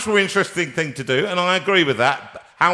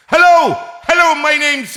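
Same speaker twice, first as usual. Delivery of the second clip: much higher, louder put-on sat nav greeting; sat nav accent voice; 14 semitones higher, 10 dB louder than usual. Host mimics a sat nav with accents, bright put-on greeting. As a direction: sing it out like a chirpy navigation voice. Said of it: "I like the ones with accents".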